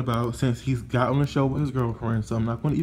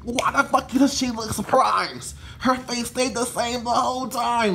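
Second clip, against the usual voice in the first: higher, indignant friend voice; voicing Mona; 7 semitones higher than usual. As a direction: Mona fuming, pitched up all the way through. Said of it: "Mona's like".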